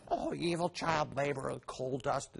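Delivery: put-on hushed lament; mock dread. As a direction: feign pity, keeping it soft. Mocking the child labor worry.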